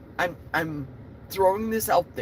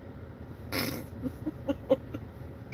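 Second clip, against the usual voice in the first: embarrassed laugh; 8 semitones higher, 8 dB quieter than usual. Real, not acted. Embarrassed about chewing gum live.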